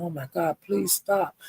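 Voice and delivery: soft, hushed plea; pleading softly. Retold or imitated. Narrator pleading with the fighters to stop, soft plea. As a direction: beg them quietly to stop, under your breath. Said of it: "I was saying".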